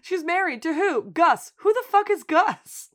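high, loud incredulous demand; outraged disbelief. Retold or imitated. Siblings grilling about the husband, loud disbelief. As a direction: build to a shocked demand. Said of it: "a hilarious round of".

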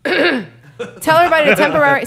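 coughing through the words; clearing throat mid-sentence; chesty cough. Real, not acted. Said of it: "sorry, I'm, I'm coughing".

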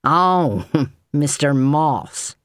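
scornful little laugh on the name; withering. A narrator's reading. James dismisses the butler with a withering, slow laugh. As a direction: dismiss him with a sneering laugh. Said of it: "James said witheringly".

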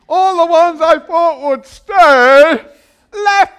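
loud high slow preaching; preaching at full force. Acted. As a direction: preach it out big. Preaching crescendo.

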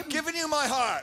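mock pleading protest. Mock-arguing with Jesus.

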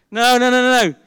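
loud, firm no repeated; emphatic no. Real, not acted. Preacher to congregation, loud emphatic refusal. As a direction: shut the idea down hard.

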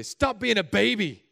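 loud, slow, scolding bark; mock harsh dad. Bad father scolding his bleeding child, mock harsh. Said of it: "No, I'm just kidding".

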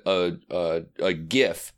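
usual speaking voice

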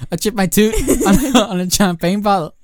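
put-on high northern accent breaking into laughter. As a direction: imitate a coworker's northern accent, cracking up midway. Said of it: "the accent you're putting on".

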